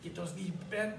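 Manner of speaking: ordinary delivery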